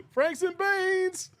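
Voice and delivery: high, long-drawn holler of a movie catchphrase; mock yell. Streamer quoting a movie catchphrase, high mock yell. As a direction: stretch it out in a high, silly yell. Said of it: "I'm just gonna yell".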